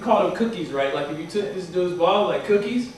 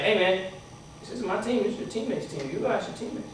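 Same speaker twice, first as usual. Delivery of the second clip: cool rebuff; 8 dB quieter than usual. Retold or imitated. Coach coolly rebuffs returning player.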